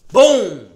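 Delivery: shouted impact noise, falling; loud boom sound. Retold or imitated. Bursting from cover, a shouted boom. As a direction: blast out a BOOM like a hit. Grabbing him from behind.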